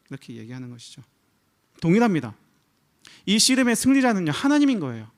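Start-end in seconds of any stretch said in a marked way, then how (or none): 1.8-5.1 louder, higher preaching push; preacher raising the voice to declare the winner; emphatic preaching. Acted. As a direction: drive the point home from the pulpit. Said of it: "preacher's emphatic climax".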